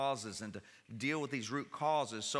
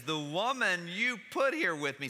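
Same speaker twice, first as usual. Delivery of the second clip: Adam blaming; 7 semitones higher, 6 dB louder than usual. Retold or imitated. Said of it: "But he says".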